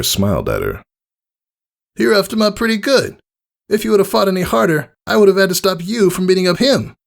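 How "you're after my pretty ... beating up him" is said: high, brash and friendly; cocky praise from a hero; bold tomboy mare voice. Acted. Rainbow Dash praising Scootaloo after the fight, brash and friendly.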